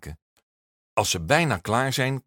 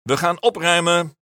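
loud, high call to the class; cheerful teacher's loud call; 9 semitones higher, 7 dB louder than usual. A narrator's reading. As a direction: clap and call the class to order. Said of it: "klapt in zijn handen en roept".

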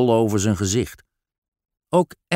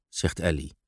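plain reading voice

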